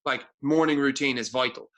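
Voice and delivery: ordinary talk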